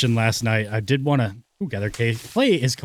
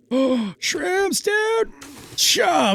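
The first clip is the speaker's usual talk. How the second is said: very high, excited whoop; excited squeal; much slower than usual. Real, not acted. Finding a mushroom after long search.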